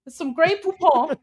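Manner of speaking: mock British accent. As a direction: ham up a fake British accent. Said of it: "my accent is British approved".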